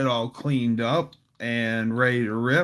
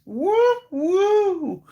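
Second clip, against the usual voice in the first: high falsetto whoop. Retold or imitated. Song whoop.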